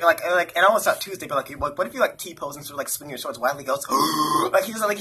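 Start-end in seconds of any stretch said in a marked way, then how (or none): 3.9-4.5 imitating a friend's excited gasp; mock gasp of excitement. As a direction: suck in a big gasp of excitement. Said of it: "he does, like, this gasp whenever he's excited".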